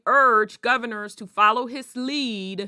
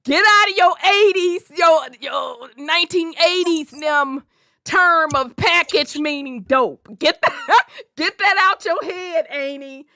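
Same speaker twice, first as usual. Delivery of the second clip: higher, louder, laughing lecture; loud explaining to auntie; 5 semitones higher, 5 dB louder than usual. Retold or imitated. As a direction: set auntie straight with a loud grin. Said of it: "I'm like".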